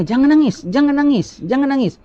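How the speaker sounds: high-pitched mock urging to stop crying; imitating hushing mourners. Preacher mimics relatives telling a grieving person, high-pitched mock hushing.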